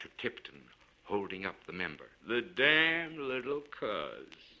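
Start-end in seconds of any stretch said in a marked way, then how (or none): 2.2-4.5 a rough oath said with hidden affection; gruff tenderness. A narrator's reading. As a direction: Kane Tuck pulling his finger free, gruffly tender. Kane Tuck repeating his remark.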